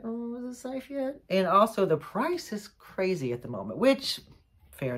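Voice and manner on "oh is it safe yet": high, soft little worried voice; mock cautious worrier. Imitating people unsure about travelling home, mock cautious. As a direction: ask it timidly, higher and softer. Voicing everyone's cautious worry.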